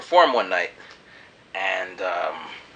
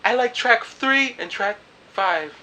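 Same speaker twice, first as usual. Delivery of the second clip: higher and slower imitation of her; girly voice, slow; 11 semitones higher, slower than usual. The girl picking her favourite tracks, high and slow. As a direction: say it sweetly and deliberately. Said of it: "she's like".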